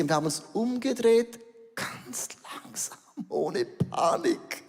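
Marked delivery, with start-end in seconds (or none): 1.7-4.6 whisper. Retold or imitated. Retold slow turn from the fish.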